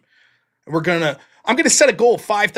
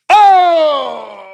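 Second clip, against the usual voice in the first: loud exclamation; 10 semitones higher, 11 dB louder than usual. Real, not acted. Sudden shout after the bit.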